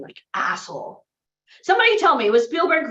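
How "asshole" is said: low, quieter grumbled curse; muttered insult. Retold or imitated. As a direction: spit it under your breath while walking off. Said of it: "huffing and puffing".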